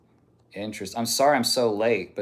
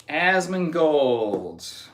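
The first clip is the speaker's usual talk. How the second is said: drawn-out name; 3 semitones higher, much slower than usual. Acted. Streamer slowly drawing out the name.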